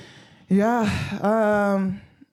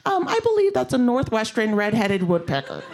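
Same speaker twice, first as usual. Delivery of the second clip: put-on higher, prim know-it-all kid voice; mock-earnest nerdy announcement, pitched up; earnest young birdwatcher; 4 semitones higher than usual. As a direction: pipe up like a keen little birdwatcher.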